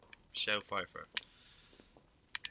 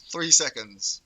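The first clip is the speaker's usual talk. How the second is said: excited; 2 semitones higher, 11 dB louder, slower than usual. Real, not acted. Repeats the timer loudly.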